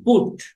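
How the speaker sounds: পুট said with a short rounded "u" like "put"; pronunciation demo. Shown as a demonstration. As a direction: model পুট the way you'd say "put". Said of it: "পিইউটি উচ্চারণ কি". (পুট শব্দটা ছোট গোল "উ" দিয়ে উচ্চারণ)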